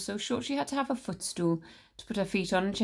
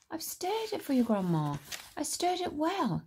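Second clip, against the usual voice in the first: put-on meek boy George; 9 semitones higher than usual. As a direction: patiently reassure grandma. George answers meekly.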